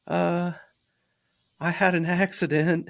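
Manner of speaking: put-on high, small, sheepish kid voice; little son's voice. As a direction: squeak it out like a guilty seven-year-old. Dad voicing son's confession.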